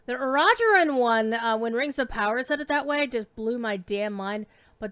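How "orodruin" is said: Orodruin punched loudly on its second syllable; stress on second syllable. Shown as a demonstration. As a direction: hit ROD hard in Orodruin. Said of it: "emphasis on the second syllable".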